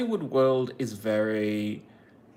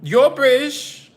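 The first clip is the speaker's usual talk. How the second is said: delighted fan; 12 semitones higher, 9 dB louder than usual. Pretend. Excited fan squeal.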